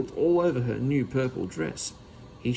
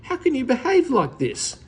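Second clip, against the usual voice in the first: scolding; 11 semitones higher, 5 dB louder than usual. A narrator's reading. Aunt scolding in public.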